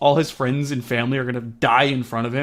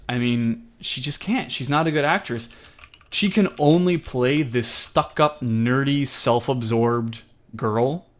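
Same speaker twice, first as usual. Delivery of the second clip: usual speaking voice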